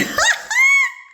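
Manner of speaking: real burst of shrieking laughter; loud high laugh. Real, not acted. Teller laughing at the card standoff, loud high laugh.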